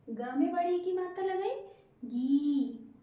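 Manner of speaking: ordinary talk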